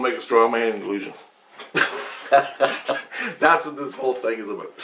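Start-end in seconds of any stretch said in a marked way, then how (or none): 1.6-3.3 short burst of laughter; real, unvoiced chuckling laugh. Real, not acted. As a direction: laugh at your own point. Laughs at own remark.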